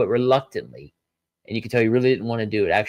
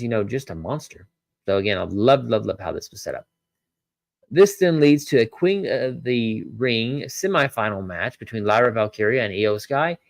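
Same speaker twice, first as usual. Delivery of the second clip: ordinary talk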